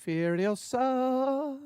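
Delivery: Furiosa stretched very slowly and pitched high; dramatic drawn-out title. Acted.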